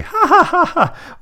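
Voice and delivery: high, hammed-up "ha ha ha"; overdramatic fake laugh. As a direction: imitate an exaggerated, very high-pitched laugh. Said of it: "then she laughs overdramatically".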